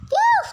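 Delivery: loud squeaky sigh of relief; high relieved phew. Acted. Relief after close call.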